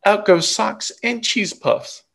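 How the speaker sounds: usual speaking voice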